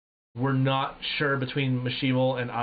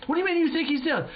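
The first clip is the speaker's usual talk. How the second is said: frantic question; 14 semitones higher, much faster than usual. Retold or imitated. Teammate demanding to know if the enemy is down, frantic high yell. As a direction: rattle it off in a panic. Panicked teammates after firing.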